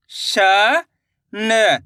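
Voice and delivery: ordinary talk, nothing marked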